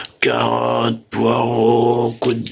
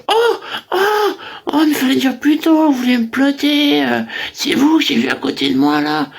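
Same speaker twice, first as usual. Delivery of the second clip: mock delighted woman; 14 semitones higher than usual. [name] voices a woman who likes it, high and giggly. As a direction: squeal like a flattered lady.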